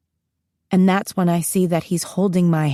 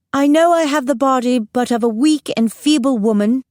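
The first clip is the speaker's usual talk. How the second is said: put-on higher, regal British accent; best British accent; 5 semitones higher, 3 dB louder than usual. A narrator's reading. Student playing Queen Elizabeth to her class, British accent. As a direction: address your lords in your best British accent. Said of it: "in my best British accent".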